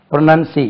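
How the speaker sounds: ப்ரொனன்சி stopped short after "see"; ப்ரொனன்சி with "nun" in the middle; syllable breakdown. Shown as a demonstration. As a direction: say ப்ரொனன்சி as "pro-nun-see", then halt. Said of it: "இங்க தான் நிறுத்தணுங்க".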